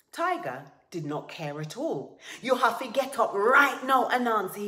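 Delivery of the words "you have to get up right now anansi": high, very loud command; angry shouted order. A narrator's reading. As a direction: shout him out of bed. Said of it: "he shouted".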